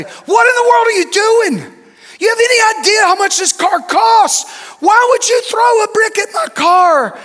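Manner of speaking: high, loud furious yelling; screaming at a child. Retold or imitated. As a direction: yell at the kid who hit your car. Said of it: "began to scream at that child".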